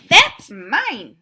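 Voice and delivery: loud, high indignant cry; cat's angry claim. Acted. Cat yells at Duck over the spoon, loud cry. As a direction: shout it as the possessive cat. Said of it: "Oh, no, hold on".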